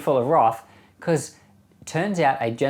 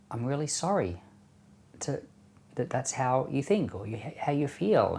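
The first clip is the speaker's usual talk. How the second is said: soft, halting sympathetic reply; gentle apology; 6 dB quieter than usual. Pretend. Answering someone who called you nasty things, gently. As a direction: feel for the words quietly and gently. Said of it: "or you could very gently say".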